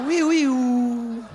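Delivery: put-on slow, stretched childish woo noises; loud silly kid sounds; playful kid noises. Kids playing, sung-out woo noises.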